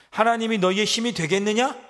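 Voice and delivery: enemy sneering at their God; mocking taunt. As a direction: throw the question at them with contempt. Assyrian mockery of God.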